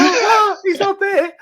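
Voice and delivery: high, squawked alarm; panicked disbelief. Retold or imitated. Musician realising the bandmate is missing, panicked. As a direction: act out sudden stage panic.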